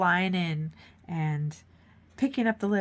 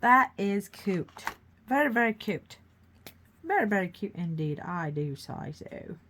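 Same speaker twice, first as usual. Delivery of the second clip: louder posh Brit admiring the picture; posh British accent; 3 semitones higher, 5 dB louder than usual. Pretend. Host keeps admiring her painting in posh British accent. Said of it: "I make a lot of accents".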